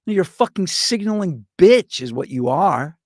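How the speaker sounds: ordinary delivery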